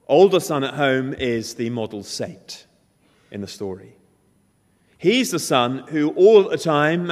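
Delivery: rising preacher's fervour; preaching at full force. Acted. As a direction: push the point home from the pulpit. Preacher builds the point.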